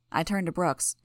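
usual speaking voice

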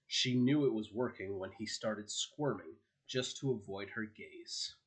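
ordinary delivery, nothing marked

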